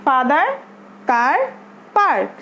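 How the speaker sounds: "father", "car", "park", one at a time, "ah" held long; drawn-out "ah". Shown as a demonstration. Teacher checking learners got it, "father", "car", "park" with long "ah". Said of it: "একটু আমাদের টেনে পড়তে হবে".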